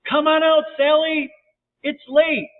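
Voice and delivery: high, loud holler into the dark; yelling. A narrator's reading. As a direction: yell it far, pitched right up. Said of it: "They yelled again".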